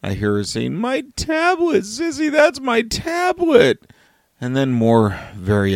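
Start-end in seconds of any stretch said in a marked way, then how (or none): 0.8-3.9 put-on high, wailing little-girl voice, upset over her tablet; imitating a distraught crying daughter. Dad imitates his upset older daughter crying over her tablet. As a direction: play the six-year-old near tears about her tablet. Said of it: "that is one distraught cry".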